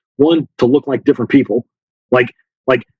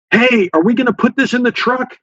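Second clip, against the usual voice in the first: high-pitched mock call-out; staged shout; 9 semitones higher than usual. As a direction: pretend to shout it across for others to overhear. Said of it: "they would shout in English".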